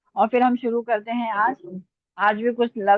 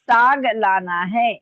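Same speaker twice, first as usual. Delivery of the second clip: slow, measured words; slow dictation; 3 dB louder, slower than usual. Real, not acted. Teacher giving the line to write below, slow dictation. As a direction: dictate it without hurry. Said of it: "मैं आराम आराम से बोल रही हूँ".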